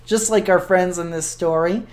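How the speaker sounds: ordinary delivery, nothing marked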